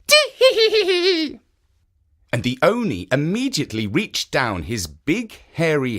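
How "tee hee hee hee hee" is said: high, loud tee-hee giggle; old woman's high giggle. A narrator's reading. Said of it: "could not help laughing".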